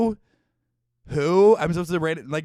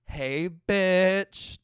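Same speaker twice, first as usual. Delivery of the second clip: put-on cocky cool-girl greeting, a bit louder; cool Supergirl; 5 semitones higher, 5 dB louder than usual. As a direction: swagger in like a teen hero. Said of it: "seventeen-year-old cool Supergirl".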